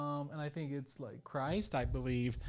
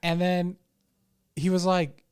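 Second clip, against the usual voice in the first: ordinary delivery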